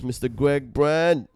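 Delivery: slow, announcer-style guest name; grand intro. Acted. Host introducing his guest, announcer-style. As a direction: roll out the name like a showman.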